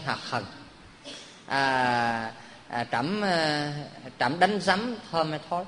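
low, soft, furtive king asking; a king muttering low so the court can't hear; hushed king's question. Acted. King quietly asks his courtier if his fart smelled, hushed.

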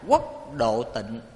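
slow, measured phrases with long pauses; deliberate call-and-repeat recitation; leading group recitation. Real, not acted. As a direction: lead the congregation phrase by phrase. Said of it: "Mời quý vị cùng nói với thầy".

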